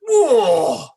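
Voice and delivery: high, booming "whoa" of amazement; hammed-up presenter's loud gasp of wonder; big, over-loud astonished "whoa"; loud mock "whoa". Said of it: "in a much louder and like".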